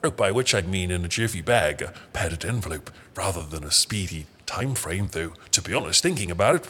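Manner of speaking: ordinary delivery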